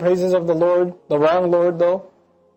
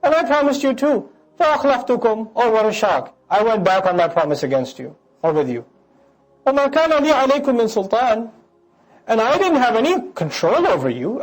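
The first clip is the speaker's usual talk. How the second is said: higher, smug Shaitan shrugging off blame; Shaitan speaking; 4 semitones higher, faster than usual. Acted. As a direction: play the devil as a slick salesman washing his hands. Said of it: "their leader's leader now speaks".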